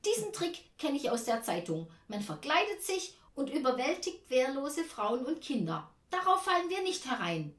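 high, loud, agitated refusal; indignant call. A narrator's reading. Aunt accusing the visitor of a trick, calling indignantly. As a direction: lecture the trickster through the door. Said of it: "ruft Tante Ernie".

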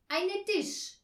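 loud, high learner's answer; proud. A narrator's reading. Herr Marconi naming the table wrongly, proud. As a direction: announce "Eine Tisch" proudly, pitched high. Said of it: "sagte Herr Marconi stolz".

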